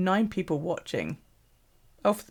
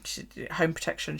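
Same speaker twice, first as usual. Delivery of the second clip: ordinary talk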